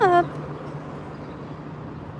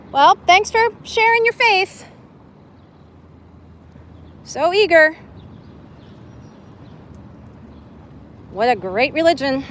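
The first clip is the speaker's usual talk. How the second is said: sarcastic; 3 semitones higher than usual. Real, not acted. Mocking Scientologists who walk away.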